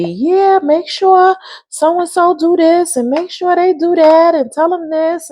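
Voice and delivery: high, instructing parent voice; bossy parent. Retold or imitated. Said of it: "the parents just be".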